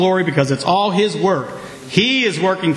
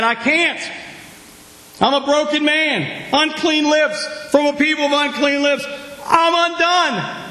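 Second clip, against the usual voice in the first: desperate plea; 7 semitones higher than usual. Pretend. Isaiah voiced pleading unworthiness.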